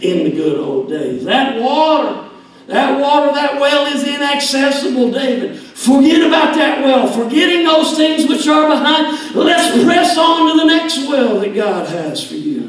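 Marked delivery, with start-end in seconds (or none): 1.2-12.7 louder, higher preaching to David at full force; fiery preaching. Acted. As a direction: drive it home like a revival preacher.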